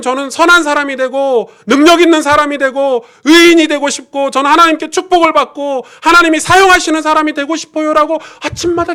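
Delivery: high, loud fervent preaching; preaching at full force. Real, not acted. Pastor urging the congregation to pray, fervent loud preaching. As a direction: drive the point home to the congregation.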